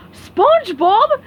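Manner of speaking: high, loud, slow alarmed shout; yelled. Acted. Patrick sees SpongeBob unpack the toy, loud alarmed yell. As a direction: gasp at the opened box. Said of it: "yelled Patrick".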